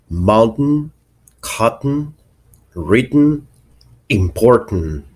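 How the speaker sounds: "mountain, cotton, written, important" with a glottal stop for the T. Shown as a demonstration. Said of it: "sometimes it's a glottal stop".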